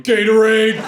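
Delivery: hammed-up imitation of an eager boyfriend; dim excited boyfriend. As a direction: blurt it out like a proud simpleton. Connor's dim, proud answer, acted.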